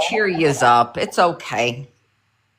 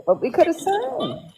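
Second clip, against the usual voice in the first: high sing-song; 11 semitones higher, 6 dB quieter than usual. Real, not acted. Repeats it a third time, high.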